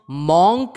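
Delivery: मॉन्क loud, with open "aw"; rounded o in मॉन्क; wrong pronunciation. Shown as a demonstration. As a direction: say मॉन्क as MAWNK. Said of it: "नहीं होता है".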